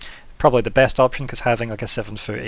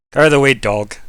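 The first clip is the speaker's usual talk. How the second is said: gruff farmer; 2 semitones higher, 9 dB louder than usual. Acted. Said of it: "gruff voice being like".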